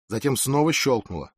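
ordinary delivery

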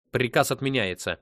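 loud, abrupt command; sharp, shouted order; barked. A narrator's reading. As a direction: cut in with a forceful bark. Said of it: "рявкнул Сет".

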